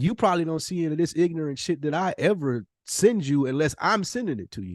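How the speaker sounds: ordinary delivery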